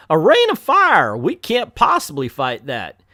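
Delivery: hammed-up Southern mayor, loud start then dropping; Southern accent. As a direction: restart the mayor's Southern alarm, bigger on the opening. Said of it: "gave the mayor an a, a southern accent".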